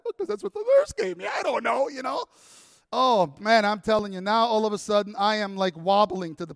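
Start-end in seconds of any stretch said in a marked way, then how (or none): 0.0-2.3 high, loud, whiny self-justifying; flustered defence. Retold or imitated. Speaker answering friend who scolds him over Benadryl, flustered defence. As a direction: protest helplessly that it isn't your fault.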